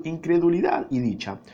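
ordinary delivery, nothing marked

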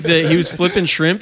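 normal talking voice

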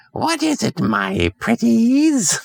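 high, slow wicked witch drawl; high witchy croon, stretched out slowly; cackling witch voice. Acted. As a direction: purr it like a storybook witch. Witch answers the call.

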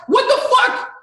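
indignant rant with high, punchy bursts; exasperated, incredulous scolding; fired up. Real, not acted. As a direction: lay into them, pitch leaping up in disbelief. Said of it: "I get fired up".

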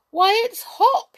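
high, loud exclamation of surprise; astonished cry. A narrator's reading. Gobbo recognising Hop, astonished. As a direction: exclaim it in amazement. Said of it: "he cried in astonishment".

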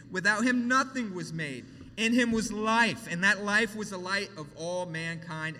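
loud, ringing scripture reading; preaching at full force. Acted. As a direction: proclaim it to the whole room. Preaching the creation verses.

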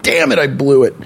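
normal talking voice

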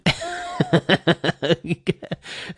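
high chuckling laugh. Real, not acted. Fan laughing about needing therapy again, real laugh. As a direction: laugh at your own joke.

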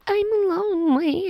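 very high, whiny little voice; imitated needy sister; mock pitiful.

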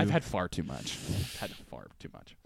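low quiet overfed moan; mock groaning full. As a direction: moan like you overate. Mock stuffed refusal.

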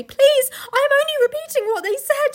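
put-on very high, loud, frightened boy; desperate pleading squeal of words; stammered plea. A narrator's reading. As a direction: protest in a panicky high voice. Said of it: "stammered Edmund".

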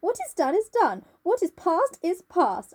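high, theatrical refusal to listen; dramatic insistence. A narrator's reading. Dorian's outburst read out dramatically.

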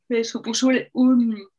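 yawning mid-sentence. Real, not acted. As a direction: talk through a yawn. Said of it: "qué sueño tengo".

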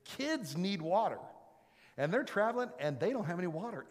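loud sermon storytelling; fired-up preaching. Real, not acted. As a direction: preach it out big. Preaching at full force.

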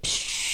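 long hushing "psh" of liquid; imitated spray noise. Telling how it ricocheted at the bar, imitated spray. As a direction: let out a sustained spray hiss.

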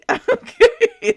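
high chuckling burst; laugh. Real, not acted. Amused at being preached to, high laugh.

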